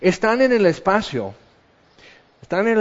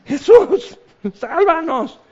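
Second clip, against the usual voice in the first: much higher, loud cry for rescue; desperate scream; 10 semitones higher, 3 dB louder, slower than usual. Retold or imitated. Woman on the sinking boat screaming loudly to Jesus. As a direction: scream it as the waves hit. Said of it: "empiezas a gritar".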